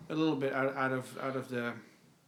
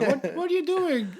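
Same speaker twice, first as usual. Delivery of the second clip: mock Gertrude; 10 semitones higher, 3 dB louder than usual. Mocking Gertrude in a scene.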